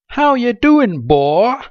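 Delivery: very high, loud hammed-up Southern greeting; mock Southern accent. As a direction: boom it out in an exaggerated Southern accent. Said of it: "speaking in an accent like that".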